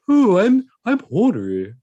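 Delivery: high posh purr announcing himself; posh horny voice. Pretend. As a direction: play a pompous posh man, all innuendo. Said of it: "a posh person announcing they want sex".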